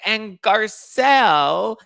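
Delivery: high, loud, slow drawn-out mock accent; mad Dorit impression. Imitating Dorit getting mad at the cast, high and drawn out. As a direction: stretch it out like an affronted socialite. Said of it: "Dorit's getting mad".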